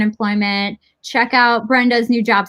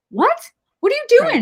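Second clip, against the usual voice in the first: imitating her dad, high, rising disbelief; startled dad. Quoting her dad on the phone, mock startled. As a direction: squeak it out like a baffled father. Dad's reaction quoted on phone.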